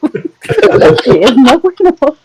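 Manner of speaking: slow and giggling through the phrase; laughing. Acted. Repeating the joke clip line for friends, slow and laughing. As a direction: drag out each word while laughing.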